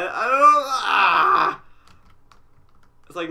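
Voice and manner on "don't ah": real fright, high loud yelp; startled scream. Real, not acted. Player jumping at a scare in the dark, startled scream. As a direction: stammer breaking into a loud scream. Jump scare in horror game.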